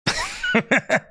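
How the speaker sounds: very high giggle; high squeak of laughter; squeaky high laugh. Real, not acted. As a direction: burst into a tiny squeal of laughter. Laughs at own joke.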